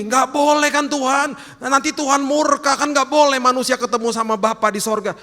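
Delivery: high-pitched, pleading objection; anxious protest. Acted. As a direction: protest loudly as a worried believer. Acted human spirit protesting to God.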